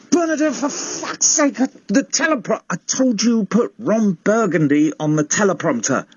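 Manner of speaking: high-pitched irate rant; exasperated tantrum. Acted. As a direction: squeal at a hapless assistant, pitch way up. Rants at assistant over ruined sketch.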